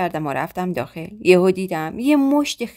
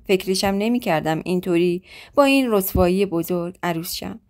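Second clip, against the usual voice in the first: ordinary delivery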